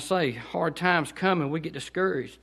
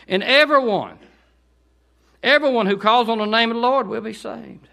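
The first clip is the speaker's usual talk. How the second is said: raised preaching read; preaching scripture; 5 semitones higher, 6 dB louder than usual. Acted. Preacher reading scripture to the congregation, fervent. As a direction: proclaim the verses with fervor.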